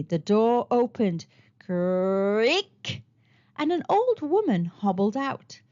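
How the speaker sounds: plain reading voice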